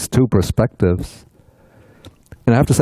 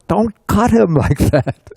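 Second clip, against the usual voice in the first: mock scream; 11 semitones higher than usual. Monk watching an autopsy wants to stop the doctor, high mock scream. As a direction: imitate an urge to scream out, laughing. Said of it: "ready to scream out".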